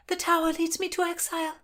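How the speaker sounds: put-on high-pitched girl pleading her exile; young noblewoman announcing her flight; young woman's voice. Narrator voices young Constance.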